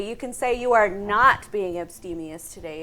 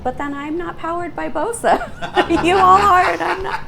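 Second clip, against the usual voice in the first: laughing; 7 semitones higher, 8 dB louder than usual. Real, not acted. Joking about not having coffee.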